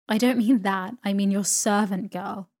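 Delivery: loud, brisk impatience; impatient correction. A narrator's reading. Aunt setting the young mother straight, impatient and loud. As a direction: correct them forcefully, raising your voice.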